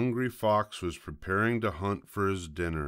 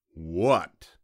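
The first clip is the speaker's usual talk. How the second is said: louder, falling fox growl; mildly growled; 6 dB louder than usual. A narrator's reading. Fox interrupted by a stalling piglet, lightly growling. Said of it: "growled the fox".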